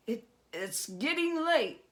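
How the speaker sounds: high, stammering goblin voice; a jittery little goblin stalling with a stutter. Acted. Goblin nervously reminds Herschel it is late, high stammer.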